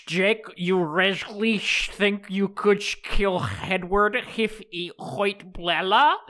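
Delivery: slow Scottish Sean Connery voice; Scottish brogue, drawn out slowly; Sean Connery impression. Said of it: "I didn't know Sean Connery was in this fucking movie".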